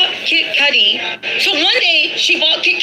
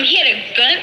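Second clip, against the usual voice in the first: ordinary talk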